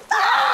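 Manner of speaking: short high yelp of fright; mock scream. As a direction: squeal like a scared kid. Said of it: "I'd be like".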